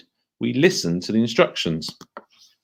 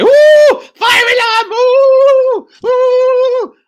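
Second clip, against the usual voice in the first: mock panicked high shrieks; panicky child; 10 dB louder, much slower than usual. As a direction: squeal like a flustered kid at the alarm. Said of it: "And that's not helpful".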